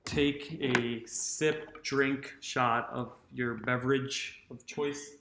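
very slow, spaced-out, softening to a hush; slow, soothing guidance. Pretend. Host calming listeners after the wild scuffle, slow soothing guidance. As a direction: ease them into it, drawing out each word.

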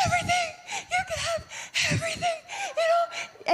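high, fast, laughing cry of surrender; giddy offering to God. Retold or imitated. Worshipper telling God he can have everything, laughing high and fast. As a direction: squeal it out, overjoyed and laughing. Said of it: "telling God".

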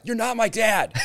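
high, laughing "you're not my dad"; mock defiant kid. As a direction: whine it like a defiant teen. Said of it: "I'd be like".

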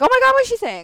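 sudden high loud gasp of alarm; startled realization. Retold or imitated. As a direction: snap out of a daydream in shock. Snapping out of daydream, alarmed.

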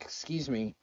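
calm but firm, gently cutting in; polite. Retold or imitated. Kamala stopping the interrupting Pence, politely and quietly. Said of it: "did it in a polite way".

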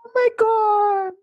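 very high, slow, drawn-out amazement; stunned at how little honey a bee makes; amazed. Real, not acted. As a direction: gasp it out in awe. Reacting to bee honey fact.

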